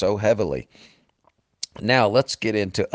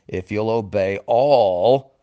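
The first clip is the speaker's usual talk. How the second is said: "all" held long and strong; stress on "all"; 5 dB louder, slower than usual. Real, not acted. Preacher voicing God's condition, stressing "all". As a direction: build up to a weighty, sustained "all". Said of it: "I emphasize that word every time".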